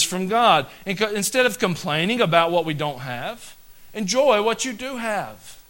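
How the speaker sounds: loud, high, slowed-down preaching; fiery preaching. Acted. Pastor exhorting the congregation, full-force preaching. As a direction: bear down on every phrase like a revival preacher.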